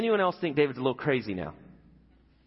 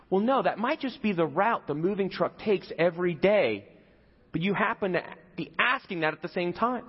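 ordinary delivery

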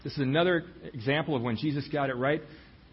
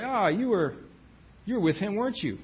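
higher, louder accusing cry of "you were with him"; accusing bystanders; 7 semitones higher, 3 dB louder than usual. Acted. Bystanders confronting Peter, higher and louder accusing voice. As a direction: point the finger and call him out.

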